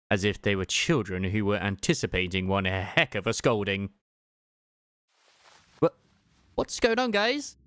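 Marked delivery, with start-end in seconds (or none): none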